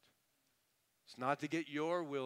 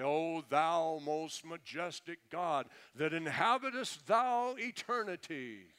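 slow, pompous prayer voice, rising; mock grand oratory; 2 semitones higher, 4 dB louder, slower than usual. Preacher mimics people praying in fancy words, mock grand oratory. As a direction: intone it like overblown King James praying. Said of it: "trying to have great oratory or fancy words".

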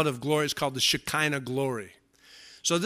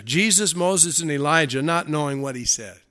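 usual speaking voice

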